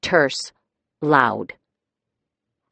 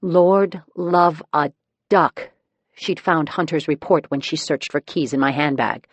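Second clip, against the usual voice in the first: usual speaking voice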